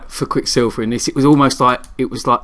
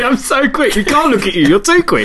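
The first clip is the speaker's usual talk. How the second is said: mocking speedster; 11 semitones higher, slower than usual. Mocking the cocky speedster character.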